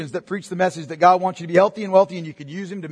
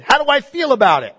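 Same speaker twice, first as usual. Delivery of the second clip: mocking; 8 semitones higher, 5 dB louder than usual. Mocking the feelings-based listener.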